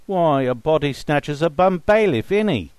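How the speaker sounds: hearty joke. A narrator's reading. Faber jokes, group laughing.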